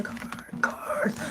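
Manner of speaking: whispery, voice giving out; losing voice. Real, not acted. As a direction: voice fading to a whisper. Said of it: "I'm losing my voice".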